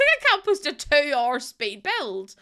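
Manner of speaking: loud, slow retold disbelief; incredulous. Streamer retelling her reaction to the long video, incredulous. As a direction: exclaim it in mock dismay.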